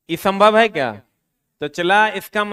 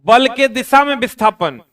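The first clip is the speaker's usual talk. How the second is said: louder, stressing बल के दिशा में विस्थापन; emphatic heading; 5 semitones higher, 6 dB louder than usual. Shown as a demonstration. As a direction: announce the heading firmly to the class. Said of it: "teacher states heading before dictating". (ज़ोर देकर शीर्षक बोलना)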